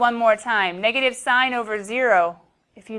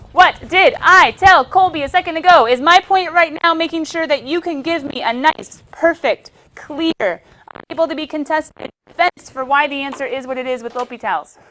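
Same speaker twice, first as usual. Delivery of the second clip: mock scolding; 5 semitones higher, 10 dB louder than usual. Teacher mock scolding the class.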